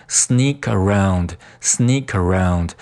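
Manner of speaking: "sneak around" joined as "snea-karound"; linked words. Shown as a demonstration. Teacher drilling liaison, "sneak around" linked slowly through the k. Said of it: "sneak和around连读在一起".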